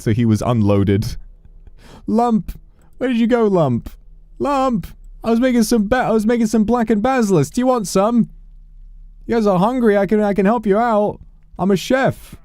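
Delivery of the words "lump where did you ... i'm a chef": very high, cartoonish calling-out voice; squeaky chef searching for a friend. Calling after vanished ogre companion.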